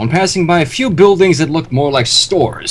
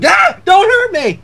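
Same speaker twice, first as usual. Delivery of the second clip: high, scared yelp; surprised yelp; slower than usual. Acted. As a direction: yelp in fright, arm up. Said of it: "He yelped in surprise".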